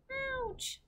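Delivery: very high, soft "ouch"; small high-pitched wince; squeamish little girl. A narrator's reading. Sophie reacts to giant's gory tale.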